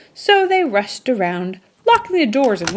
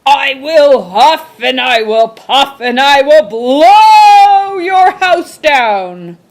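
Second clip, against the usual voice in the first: very loud, slow, higher furious roar; furious loud threat; 6 semitones higher, 10 dB louder, slower than usual. A narrator's reading. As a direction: bellow it slowly at the house. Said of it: "screamed the furious magic shark in his loudest voice".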